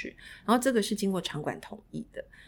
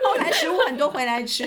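laughing; 7 semitones higher, 6 dB louder than usual. Real, not acted. Laughs retelling the scene.

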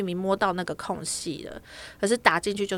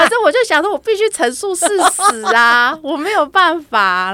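half-laughing, a bit embarrassed, stretched out; 8 semitones higher, 10 dB louder than usual. Real, not acted. Laughing while retelling the awkward exchange. (边笑边说，声音提高)

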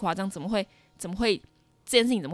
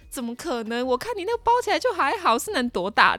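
skeptical nurse; 6 semitones higher, 5 dB louder than usual. Retold or imitated. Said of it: "她说".

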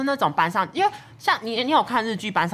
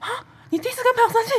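shrill-pitched disbelieving question; shocked disbelief; much faster than usual. Retold or imitated. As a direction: sound utterly stunned. Retold own shocked reply.

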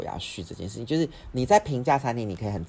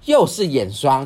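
loud, annoyed sister snapping back; fed up, let it burst out; exasperated retort; 5 semitones higher, 12 dB louder, slower than usual. Retold or imitated. Sister fed up with mom's eye cream, loud exasperation.

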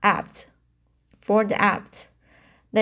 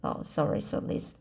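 whispered aside; 5 semitones lower, 8 dB quieter, faster than usual. Real, not acted.

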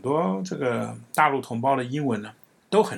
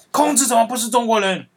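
indignant, higher and louder outburst; angry retort; 9 semitones higher, 5 dB louder than usual. Retold or imitated. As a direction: play the offended friend, voice raised in protest. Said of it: "他就很生气".

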